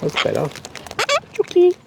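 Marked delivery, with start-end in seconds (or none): 1.3-1.7 calling chickens. Real, not acted. Calling the bird.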